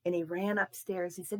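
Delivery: plain reading voice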